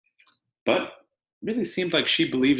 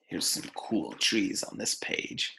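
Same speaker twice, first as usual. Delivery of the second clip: hushed whisper to the class; soft whispered side comment; whispered aside; 3 semitones lower, 7 dB quieter than usual. Real, not acted. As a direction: lean in and whisper it. Teacher aside during read-aloud.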